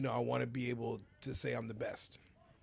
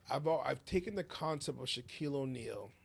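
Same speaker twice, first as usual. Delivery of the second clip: ordinary delivery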